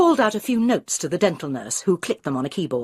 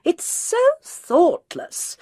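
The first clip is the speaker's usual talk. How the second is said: high, slow exasperated complaint; indignant Barbara; 13 semitones higher, much slower than usual. Acted. Barbara complaining to Jude, high and indignant. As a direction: huff it out, put upon.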